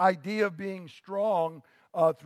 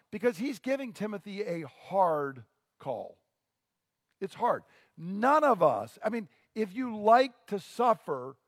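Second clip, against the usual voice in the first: ordinary delivery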